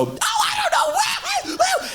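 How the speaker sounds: very high squealing mock woman, laughing. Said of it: "and women just go".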